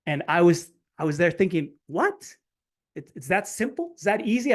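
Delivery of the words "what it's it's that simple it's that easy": stunned disbelief. Retold or imitated. Dad stunned by simple solution.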